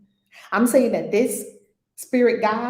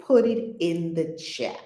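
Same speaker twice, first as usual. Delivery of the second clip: ordinary talk, nothing marked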